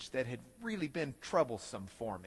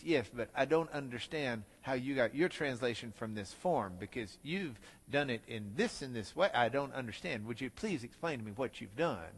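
professor voice; 7 semitones lower, 6 dB quieter than usual. Retold or imitated. Professor presses him in story.

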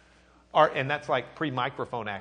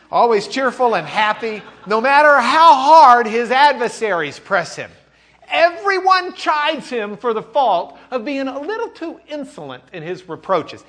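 put-on higher, louder, lively reading of an old account; reading the eyewitness report; 10 semitones higher, 12 dB louder than usual. Teacher reading an eyewitness report to the class, higher and livelier. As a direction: read it out like a proud chronicler. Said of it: "That word's been ruined".

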